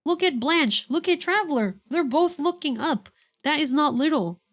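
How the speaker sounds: high, loud and protesting; indignant insistence. Acted. Paimon protesting to friends, indignant and loud. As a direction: insist it's no little flower.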